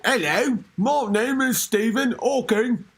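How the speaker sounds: exaggerated British Hawking voice; hammed-up British self-introduction; mock British accent. Host playing Hawking introducing himself, mock British accent. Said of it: "sound like a British".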